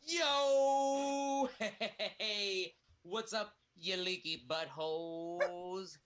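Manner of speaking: loud, high party-bro shout; high-pitched party bro yelling at the room; hyped party entrance. Acted. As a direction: burst in yelling like a frat guy. Improv character bursts in hyped.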